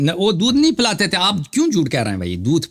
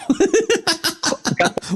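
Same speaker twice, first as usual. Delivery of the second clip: mocking laugh; 12 semitones higher than usual. Real, not acted. Mocking laugh after insult.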